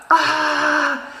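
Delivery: sharp short cry, a little high; mock panicked cry. Coworker lunging at her with a raw cry. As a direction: blurt out a startled yell mid-panic.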